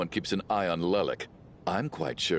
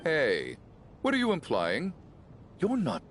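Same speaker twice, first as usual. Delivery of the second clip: indignant surprise; 7 semitones higher than usual. Acted. Man suspects hidden insults.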